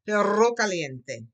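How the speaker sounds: slow, loud Perro caliente, rr trilled; Perro caliente with a rolling rr; careful Perro caliente for the class; rolled r. Shown as a demonstration. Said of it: "Roll that arm".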